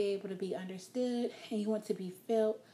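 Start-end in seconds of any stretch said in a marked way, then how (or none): none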